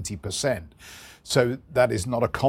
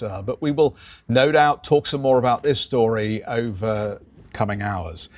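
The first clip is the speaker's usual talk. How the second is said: ordinary delivery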